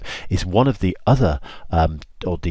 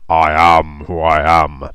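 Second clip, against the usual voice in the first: loud, very slow, booming God; drawn-out thunderous divine voice; big deep God voice; 7 dB louder, much slower than usual. Acted. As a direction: proclaim it as God, deep and grand. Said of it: "in that big, deep voice".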